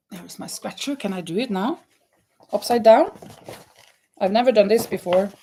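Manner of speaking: usual speaking voice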